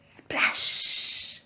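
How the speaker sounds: breathy whispered sound effect; whispered splash. A narrator's reading. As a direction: hush it like a splash under water. Read-aloud sound word.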